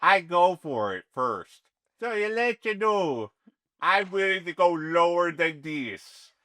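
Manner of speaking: slow Swedish-sounding mobster; Swedish accent. Acted. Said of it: "I think you're Swedish now".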